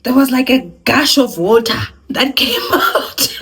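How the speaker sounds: laughing through the line; amused laughing telling. Real, not acted. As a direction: giggle as you tell it. Laughing recalling the gush.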